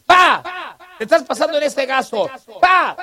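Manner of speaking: high, loud nagging daughter's voice; scolding daughter. Retold or imitated. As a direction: squeak out a daughter scolding her dad. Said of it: "Pero ella me dice". (hija regañando al papá, voz aguda y fuerte)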